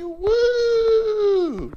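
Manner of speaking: very high, loud whoop; excited whoop. Real, not acted. Owner hyping the parrot for breakfast, loud high whoop. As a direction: whoop to hype the bird.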